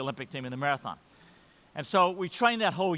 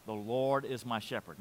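soft recitation; 5 semitones lower, 6 dB quieter than usual. Shown as a demonstration. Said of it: "just say the first line".

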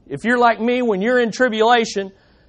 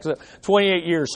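ordinary talk

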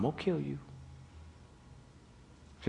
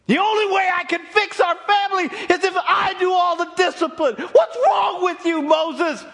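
furious wife; 13 dB louder than usual. Acted. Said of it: "She looked at him and said".

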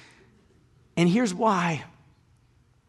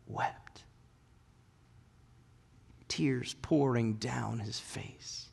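hushed narration; 2 semitones lower, 8 dB quieter, much slower than usual. Acted. Francis weeping at the manger.

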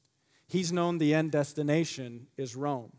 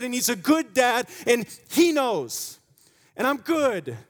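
fervent preaching; 7 semitones higher, 6 dB louder than usual. Acted. Preaching at full force.

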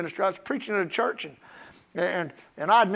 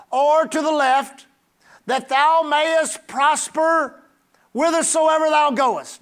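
slow, measured Bible declamation; solemn scripture reading; 5 semitones higher, 5 dB louder, slower than usual. Real, not acted. Preacher reading Joshua to the congregation, solemn emphatic reading. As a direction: proclaim each phrase, leaving space between.

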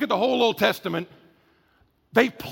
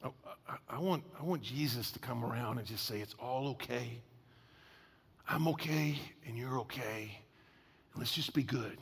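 soft, low, breathy wish; almost whisper a comforting wish; wistful hush; 9 semitones lower, 15 dB quieter than usual. Retold or imitated. Listeners longing for gentle Jesus, nearly whispered.

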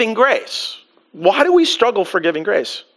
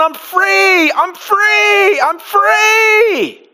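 much higher, joyful cry of freedom; overjoyed shout; 10 semitones higher, much slower than usual. Acted. Forgiven debtor running down the street, jubilant and high. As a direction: shout it out like a man just set free. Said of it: "runs up and down the street and it's going".

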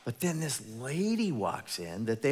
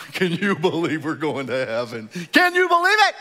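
much louder and far higher, laughing with joy; giddy disbelief; 7 semitones higher, 14 dB louder than usual. Acted. Forgiven sinners marvelling at heaven, giddy laughing shout. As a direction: burst out in delighted laughter. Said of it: "forgiven sinners walking around going".

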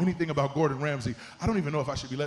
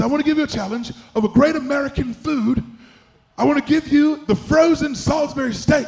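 higher-pitched, put-on English accent; 11 semitones higher, 9 dB louder than usual. Chef announcing the food challenge, mock English accent. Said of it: "my English accent".

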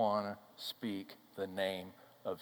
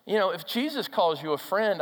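ordinary talk

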